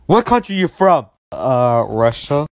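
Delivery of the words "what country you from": pushy question. Acted. Demanding answer in argument.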